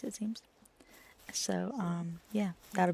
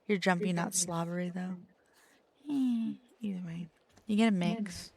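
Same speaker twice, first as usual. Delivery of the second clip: ordinary talk, nothing marked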